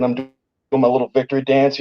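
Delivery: ordinary talk, nothing marked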